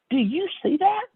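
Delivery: very high-pitched wonder; amazed friend imitation. Friend pointing out a find in the aisle, acted amazement. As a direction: ask it way up high, acting astonished.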